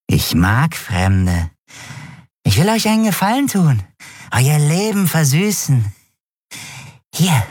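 high, wheedling junkie voice; sleazy dealer. Acted. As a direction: lure them in like a creepy peddler. Dealer bietet verschwörerisch Drogen an. (hoch und schmierig lockend)

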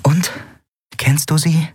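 plain reading voice

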